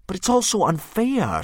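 put-on high, loud boy's outcry; a boy crying out at injustice; Hiccup protesting. Hiccup protesting to the dragon, high and loud.

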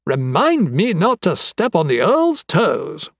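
high-pitched, animated rambling; eccentric, chatty anatomist. Acted. Anatomist reporting on three dead assassins, excitedly chatty. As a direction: marvel over the evidence with lively swoops.

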